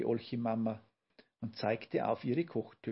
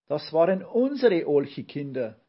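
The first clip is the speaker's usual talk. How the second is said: loud, higher exclamation; calling out; 4 semitones higher, 9 dB louder than usual. A narrator's reading. Mother hears of her children at the circus, calling out. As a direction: burst out with it loudly. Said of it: "rief Olchi-Mama".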